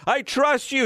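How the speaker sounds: man losing his grip, yelling to God; frantic yell. Acted. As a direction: blurt it out, barely hanging on. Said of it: "he yelled".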